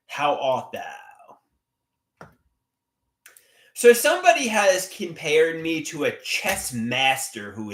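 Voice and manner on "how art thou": mock archaic, dragged out; slow drawn-out question. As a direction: stretch each word out very slowly. Drawn-out repeat of greeting.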